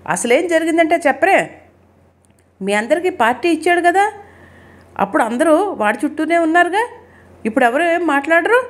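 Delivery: high-pitched, exasperated demand; angry. A narrator's reading. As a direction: challenge them indignantly, pitch held high but not shouting. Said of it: "కోపం వచ్చింది". (కోపంగా, గొంతు పెంచి)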